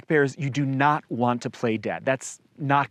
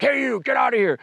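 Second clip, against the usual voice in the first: mock aggressive shout, pitched much higher; aggressive yell at bear; 10 semitones higher, faster than usual. Shown as a demonstration. Showing hikers the wrong way to address a bear, mock aggressive yell. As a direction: bark it like you're scaring off a bear, hammed up. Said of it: "not in a loud, aggressive voice, not like".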